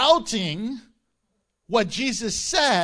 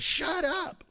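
mock yell; 9 semitones higher, 4 dB quieter than usual. Preacher retelling Jesus rebuking the wind, mock yell. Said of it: "He yells that".

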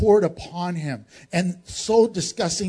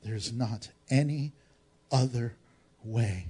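firm quiet Father; 8 semitones lower, 8 dB quieter than usual. Acted. The Father answering his Son, softly and firmly. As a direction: speak gently but leave no opening. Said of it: "And the Father says".

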